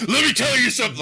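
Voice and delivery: loud, high hammed-up promo yell; wrestler promo voice. Mocking a wrestling promo with a loud high yell. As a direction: bellow it like a wrestler cutting a promo. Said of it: "let's play one of your promos".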